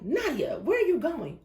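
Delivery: high alarmed call from the car; calling out to a friend walking alone; surprised shout to Nadia from the car; retold alarmed call. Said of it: "and I'm like".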